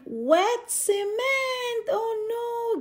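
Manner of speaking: plain reading voice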